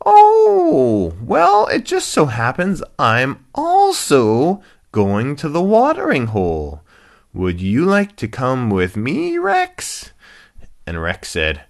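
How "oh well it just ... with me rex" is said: high, loud, eager hippo; Lucky. Acted. Happy hippo inviting rhino along, squeaky friendly voice. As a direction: invite brightly in high swoops. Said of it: "the hippopotamus".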